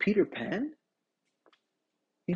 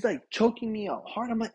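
ordinary talk, nothing marked

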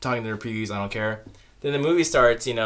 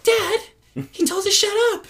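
high, loud whiny mock kid; tattling child; 5 dB louder than usual. Offended moviegoers complaining to dad, high mock tattling. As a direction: tell on someone in a squealing imitation.

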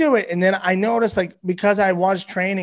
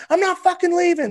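high-pitched mock defiance; defiant refusal; 12 semitones higher than usual. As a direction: retell the stubborn stand, pitched way up. Defiant refusal to leave ring.

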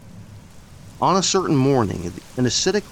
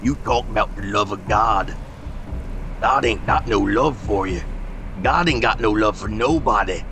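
grim rustic preacher of doom. Acted. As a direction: hammer each line down without pity. Josiah's character voice.

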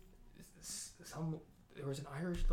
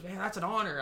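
higher, louder quote of an impressed man; all excited; 6 semitones higher, 8 dB louder than usual. Retold or imitated. Man congratulating the speaker on the degree, all excited. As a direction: gush with admiration, pitched up and loud. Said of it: "like, all excited".

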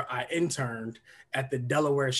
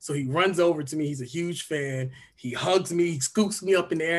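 ordinary delivery

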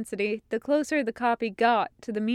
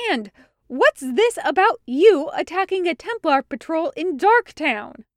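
angry; 7 semitones higher, 7 dB louder than usual. A narrator's reading. Angry captain confronts Hawk.

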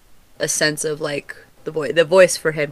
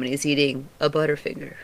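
usual speaking voice

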